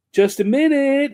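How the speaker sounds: high, loud call from behind a door; calling through the door. Retold or imitated. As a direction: sing out from inside the room.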